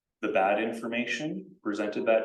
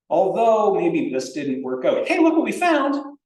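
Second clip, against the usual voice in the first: excited grant writer; 11 semitones higher, 11 dB louder than usual. Retold or imitated. A lecturer acts a grant writer ending on good news, excited. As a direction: save the thrill for hey look what we found. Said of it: "But if you go".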